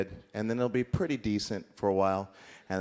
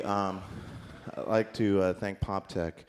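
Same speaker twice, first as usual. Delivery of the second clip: normal talking voice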